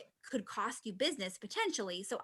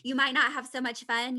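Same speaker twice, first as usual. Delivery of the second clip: usual speaking voice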